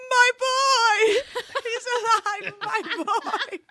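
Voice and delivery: cheering a favourite character back from the dead; overjoyed cry. Retold or imitated. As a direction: shriek with fangirl delight. Reading her delighted margin note.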